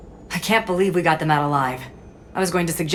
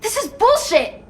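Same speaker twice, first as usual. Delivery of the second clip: angry outburst; slower than usual. Acted. Student protesting to Shepard about a support role, angry outburst. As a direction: spit out the indignation.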